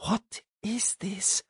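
hushed, slow whisper. A narrator's reading. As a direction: breathe it out in wonder. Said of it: "he wondered".

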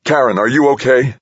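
high, loud, urgent question; tense urgency. A narrator's reading. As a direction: call out with urgent concern. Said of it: "the urgency in Brad's voice".

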